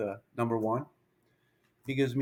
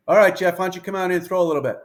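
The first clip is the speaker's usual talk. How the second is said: retold coach calling across the field, louder; 6 semitones higher, 7 dB louder, faster than usual. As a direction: call him in from the dugout.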